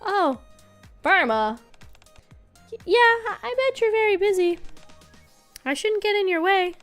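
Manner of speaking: put-on high, childlike character voice; young girl voice. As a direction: voice a shy little girl. Girl character hesitant, shy.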